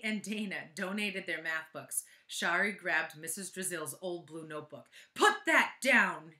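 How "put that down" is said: slow, loud teacher's yell. Acted. Teacher yelling at Shari over her notebook, loud and slow. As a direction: bark it out as a sudden, slow command. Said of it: "yelled Missus Drizzle".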